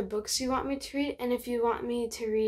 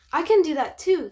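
bright, louder eager little voice; eager mouse; 5 semitones higher, 11 dB louder than usual. Acted. Mouse's eager quote in story.

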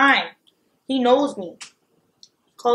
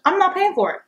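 firm refusal; 10 semitones higher, 3 dB louder than usual. Retold or imitated. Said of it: "I said".

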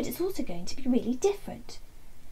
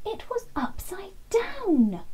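breathless, high, slow gasp of surprise; half-whispered "oh!" moment; hushed amazement at the discovery; surprised discovery; 9 semitones higher, slower than usual. A narrator's reading. Discovering the box is upside down.

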